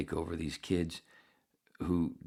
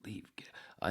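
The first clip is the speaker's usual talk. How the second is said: 1 whispered; 5 semitones higher, 5 dB quieter than usual. Retold or imitated. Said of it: "everybody that I've said".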